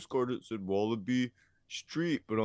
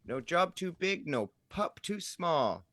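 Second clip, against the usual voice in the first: slogan voice; 8 semitones higher, slower than usual. Retold or imitated. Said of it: "that's Paw Patrol saying".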